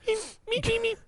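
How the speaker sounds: very high, soft squeaky meeps; Beaker. Acted. Caster imitating a Muppet for co-caster, soft high meeping. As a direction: meep like a tiny puppet. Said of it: "Remember Beaker?".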